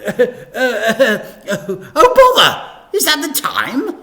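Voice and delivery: high, loud fluster and fake hurry; flustered vicar. A narrator's reading. Vicar escaping the awful tea, flustered and loud. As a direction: sputter an excuse to flee. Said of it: "pretending to check her watch".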